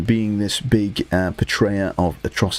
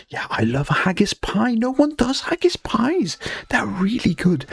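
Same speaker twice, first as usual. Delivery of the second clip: excited gush; 12 semitones higher than usual. Real, not acted. Excited haggis praise, high whisper.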